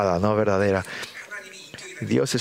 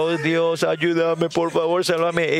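higher-pitched pleading prayer; urgente súplica; 4 semitones higher, slower than usual. Acted. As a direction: beg God for help, mildly urgent. Acted desperate prayer in need. (súplica apremiante, en tono más agudo)

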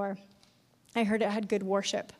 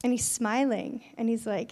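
ordinary talk

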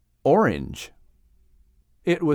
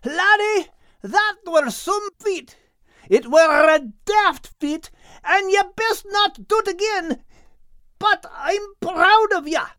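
Scottish shout. Acted. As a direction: bellow it, half scolding half proud. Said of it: "he shouted".

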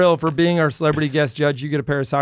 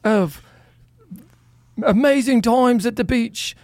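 higher-pitched hammed-up Aussie accent; an Australian accent, pitched up and overdone; mock Australian accent; 7 semitones higher than usual. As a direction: lay on a cheesy Aussie mate voice. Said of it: "you gotta see how bad they are".

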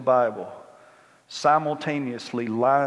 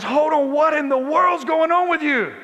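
father's surprise; 8 dB louder than usual. Retold or imitated. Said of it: "And he says".

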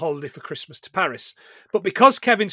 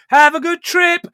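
put-on bratty kid voice; 8 semitones higher, 5 dB louder than usual. Kid neighbour quoted leaving.

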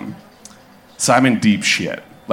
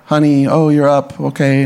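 lightly voiced, higher-pitched mom; classic caretaker mom; 5 semitones higher than usual. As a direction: imitate a caretaking mom finding her kid awake. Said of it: "super caretaker, like, classic mom".

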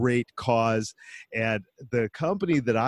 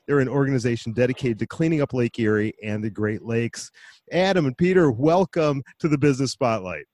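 normal talking voice

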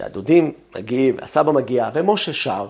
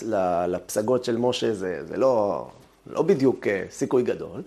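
normal talking voice

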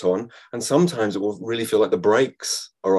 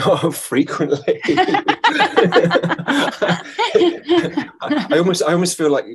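laughing while talking, pitched up; real laughter; 8 semitones higher, 5 dB louder than usual. Real, not acted. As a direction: answer through a burst of giggles. Guest cracks up at the question.

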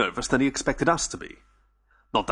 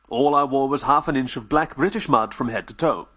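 usual speaking voice

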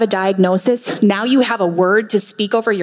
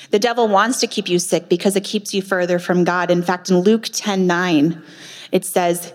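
usual speaking voice

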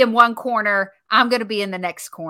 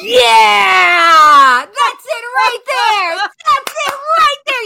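high hype shout; cocky boxer; 11 semitones higher, 8 dB louder, much slower than usual. Pretend. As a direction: shout it like a fired-up fighter. Entering the ring, hyping herself.

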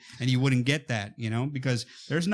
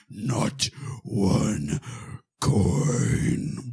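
low, drawn-out, heavy character voice; Thorin Oakenshield; 6 semitones lower, much slower than usual. Acted. Film fan imitating a greedy hoarder for a viewer, low slow impression. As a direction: stretch each word slowly, dropping into a rough low register. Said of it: "Thor and Oakenshield for somebody specific".

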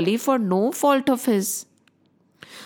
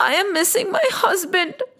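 higher, teary voice with sobbing breaks; crying Lakshmi; 6 semitones higher than usual. Acted. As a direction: tell Bali your sorrow through tears. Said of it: "She went on crying".

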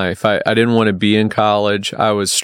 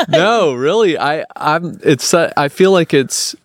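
amused protest; 6 semitones higher than usual. Real, not acted. Protesting through laughter after a joke.

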